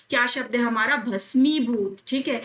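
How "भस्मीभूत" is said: ordinary talk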